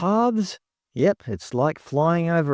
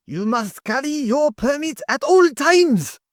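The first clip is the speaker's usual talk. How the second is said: deliberately bad German accent, pitched way up and pushed out; worst German accent. Mocking the park rules in a bad German accent. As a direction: bark the rule like a cartoon German official. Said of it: "in my worst German accent".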